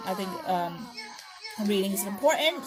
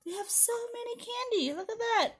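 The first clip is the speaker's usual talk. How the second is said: animated, sing-song read-aloud with high swoops; children's story narration; 10 semitones higher than usual. Acted. Teacher reads a Halloween book to preschoolers, animated storytelling. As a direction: read it to little ones, full of wonder.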